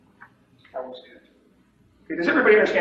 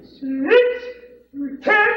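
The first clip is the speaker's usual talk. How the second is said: high, loud bid swooping upward; mock a bad bid with weird pitch leaps; strange inflections; 6 dB louder than usual. Shown as a demonstration. Showing what not to do when bidding, mock strange inflection. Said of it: "don't wanna put strange inflections in your voice".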